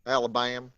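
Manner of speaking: "Alabama" squashed into a drawled "Alabam"; syllables dropped. Shown as a demonstration. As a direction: slur "Alabama" with fewer syllables, Southern style. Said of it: "get rid of a few syllables".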